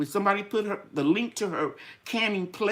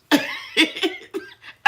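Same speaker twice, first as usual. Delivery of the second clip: breathy high giggle; 11 semitones higher than usual. Real, not acted. Laughs about her outfit.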